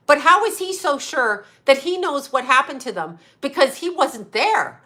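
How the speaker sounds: normal talking voice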